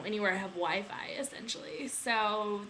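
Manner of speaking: ordinary talk, nothing marked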